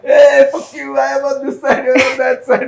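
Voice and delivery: mock angry telling-off. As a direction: yell it across the river in mock fury. Guy across river tells off.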